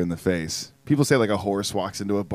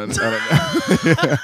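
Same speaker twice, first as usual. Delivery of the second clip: high, slow, drawn-out with a laugh; silly stretched voice; 3 dB louder, much slower than usual. Pretend. Repeating the line to a friend, silly high drawl.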